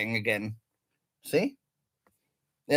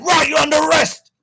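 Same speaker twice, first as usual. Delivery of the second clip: mimicking the arresting officer, loud and higher; officer's tone; 8 semitones higher, 6 dB louder than usual. Retelling the officer arresting him, loud mimic. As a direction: bark it like a cop making the arrest. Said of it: "The way he said".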